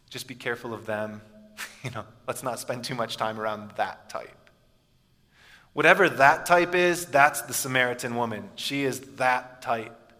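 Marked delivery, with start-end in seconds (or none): 0.1-4.5 put-on low, hushed aside to the kids; hushed warning to kids. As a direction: warn them quietly so others won't hear.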